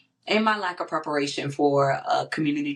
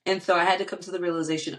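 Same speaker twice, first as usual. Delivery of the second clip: ordinary delivery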